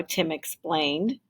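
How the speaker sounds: plain reading voice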